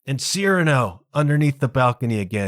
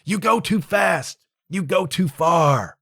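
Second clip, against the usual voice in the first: higher, hurried protest; flustered refusal; 4 semitones higher than usual. Retold or imitated. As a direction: stop him in a panic. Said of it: "he's like".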